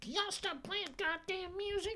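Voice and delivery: high, slow put-on girlfriend voice; squeaky mock girlfriend nagging; girlfriend complaining. Boyfriend imitating his girl telling them to stop the music. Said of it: "I know my girl like".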